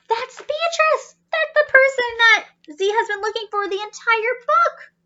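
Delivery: high, bursting with excitement; almost squealing with delight, reading to a child; excited gasp. Acted. Narrator thrilled at finding Beatrice.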